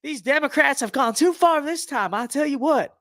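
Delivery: high, slow Southern drawl, hammed up; exaggerated Southern accent, high and slow; mock Southern Florida accent. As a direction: play a Florida good old boy griping about Democrats. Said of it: "That's more how Northern Floridians talk".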